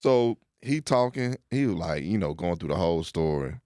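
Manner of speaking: ordinary talk, nothing marked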